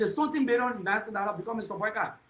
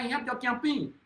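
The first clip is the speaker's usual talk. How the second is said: ordinary talk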